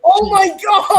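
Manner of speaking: loud shocked shout. Real, not acted. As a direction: yell it in disbelief. Crash in racing game.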